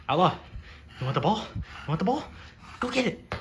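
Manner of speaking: winding the dog up to fetch; building dog-ball excitement. Real, not acted. Teasing dog with ball, rising excitement.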